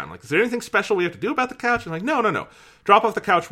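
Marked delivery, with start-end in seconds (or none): 0.2-1.8 quick, higher-pitched asking; tentative retold question. Caller checking with the store about the couch, quick high question. 2.0-3.5 high, emphatic brush-off of a worry; breezy retold reassurance. Store worker telling the caller it's no trouble, emphatic and high.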